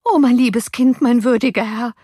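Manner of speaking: high, loud wail of distress; crying-out nurse. A narrator's reading. Nurse rushing in to master, anxious cry. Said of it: "rief die Amme".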